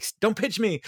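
high, wired squeal; jittery Leslie. Retold or imitated. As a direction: squeal it like she's wired on pills. Leslie jittery on Zap Vigil.

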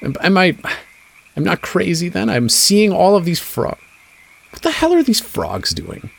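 a stranger checking his own sanity; relieved NPC. Acted. NPC voiced by game master.

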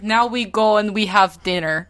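mock Russian accent; comic Russian-accented quote. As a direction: wrap up as the imitated Russian rescuers. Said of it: "giving him a Russian accent in English".

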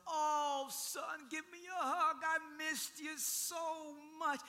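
high, hammed-up joyful greeting; gushing father. Imagined father hugging his clean son, gushing. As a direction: cry out with exaggerated longing.

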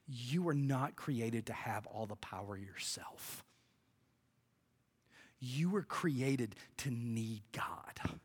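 slow near-whisper, very soft and low; hushed revelation. Real, not acted. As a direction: let the key truth land in a whisper. Preacher drops voice for key truth.

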